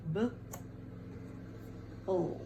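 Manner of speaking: "B-o" as buh, long pause, ull; "B-o" in two widely spaced sounds; sounding out. Shown as a demonstration. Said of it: "this goes with the second syllable".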